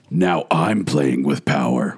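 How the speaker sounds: slow, low villain line; Freddy Krueger. Retold or imitated. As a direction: drag it out low, a mock shout without volume. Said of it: "Freddy also shouts".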